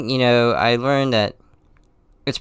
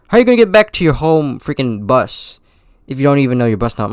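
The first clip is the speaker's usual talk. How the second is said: louder, fast, exasperated demand; mock the man snapping at him, fast and loud; retold angry staff member; 4 semitones higher, 9 dB louder than usual. Staff member snapping at lost student, loud and fast. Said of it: "it's like".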